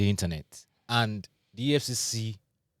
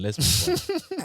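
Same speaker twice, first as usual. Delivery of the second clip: much higher and slower, comic squeak; squeaky high repeat. Shown as a demonstration.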